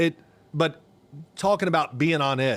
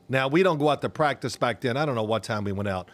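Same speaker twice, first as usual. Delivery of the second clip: usual speaking voice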